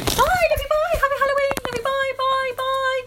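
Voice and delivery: excited goodbye. Real, not acted. Playful sign-off to listeners.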